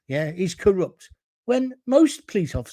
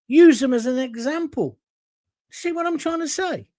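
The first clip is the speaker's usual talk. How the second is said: usual speaking voice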